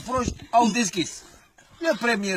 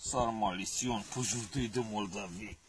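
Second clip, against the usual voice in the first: much lower and softer, sultry; low soft purr; 11 semitones lower, 12 dB quieter than usual. Acted. Goat mother luring the wolf with a low soft feminine purr. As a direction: drop low and soft to lure him. Goat's seductive feminine tactic.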